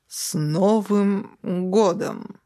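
put-on hugely drawn-out drunken cheer. A narrator's reading. Drunk dispatcher repeats his intercom greeting, woozily. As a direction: slur it very slowly, swooping on each word. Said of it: "пьяно ответил тот же голос".

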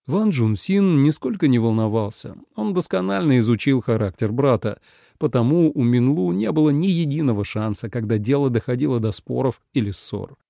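ordinary delivery

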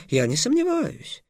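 high, light interjection; hasty reassurance. A narrator's reading. Slave trader heading off Trix's appeal to the king, placating. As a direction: cut in to placate. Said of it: "быстро сказал самаршанец".

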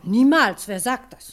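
a sudden loud, offended denial; indignant outburst. Acted. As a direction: snap back at the insinuation, loud. Secretary denies affair, indignant.

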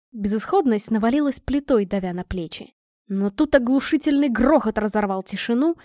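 usual speaking voice

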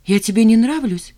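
rising, taken-aback query; surprised. A narrator's reading. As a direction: ask it in disbelief. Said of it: "удивилась она".

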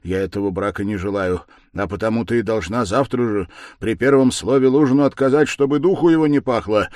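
with effort. A narrator's reading. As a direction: push the words out insistently. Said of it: "с усилием продолжал Раскольников".

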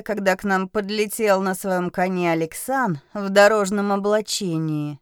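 usual speaking voice

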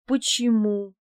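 a surprised why about the wealthy suitor. A narrator's reading. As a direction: ask it in real surprise. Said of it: "удивилась я".

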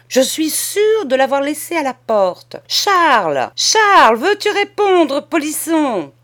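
high, loud, scolding call; cousine en colère. A narrator's reading. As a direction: shout for the naughty boy, demanding an answer. Angry cousin calls the missing boy. (appel furieux, voix haute et forte)

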